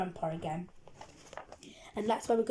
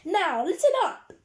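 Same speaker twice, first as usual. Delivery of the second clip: high, loud call for attention; teacher's shout; 9 semitones higher, 6 dB louder than usual. A narrator's reading. Strict teacher to noisy children on the coach, shouting. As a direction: shout over an excited hum. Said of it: "she shouted over the excited hum".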